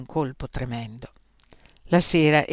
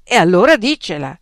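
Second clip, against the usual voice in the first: impatient demand; 4 semitones higher, 11 dB louder than usual. Acted. Examiner losing patience.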